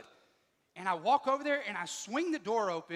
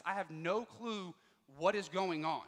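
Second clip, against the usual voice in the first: ordinary talk, nothing marked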